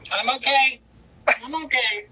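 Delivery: high, tight voice fighting tears; trying to steady herself while crying; tearful reassurance; holding back tears. Real, not acted. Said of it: "I'm not gonna cry".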